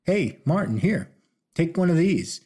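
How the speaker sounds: high call across the street. A narrator's reading. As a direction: call out after him, lifted. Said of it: "Dallas called back".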